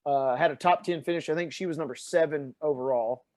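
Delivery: ordinary talk, nothing marked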